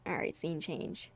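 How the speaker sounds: normal talking voice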